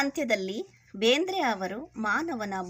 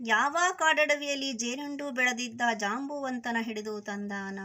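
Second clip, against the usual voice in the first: poem recitation; 3 semitones higher, 4 dB louder than usual. Acted. Teacher reads the bear-dance poem to children, sing-song recitation. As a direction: chant the verse, stretching each closing word. Recites verse before explaining it.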